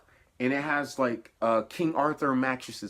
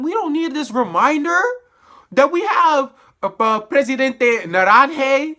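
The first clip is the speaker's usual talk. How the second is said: much higher and louder fed-up outburst; exasperated rant; 10 semitones higher, 9 dB louder than usual. Real, not acted. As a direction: vent it at the writers. Ranting about the show's politics.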